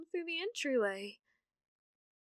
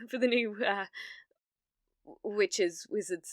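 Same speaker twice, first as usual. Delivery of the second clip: normal talking voice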